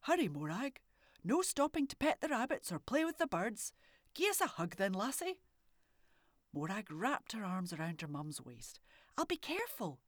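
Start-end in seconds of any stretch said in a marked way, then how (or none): 0.0-5.4 lightly voiced mum, a bit louder, urging. A narrator's reading. 9.2-10.0 put-on high little girl reassuring. A narrator's reading. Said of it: "she assured her".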